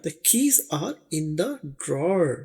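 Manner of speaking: very slow, every word spaced; slow model sentence. Shown as a demonstration. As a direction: read it out slowly for learners. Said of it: "slow model sentence for learners". (सीखने वालों के लिए बहुत धीमा वाक्य)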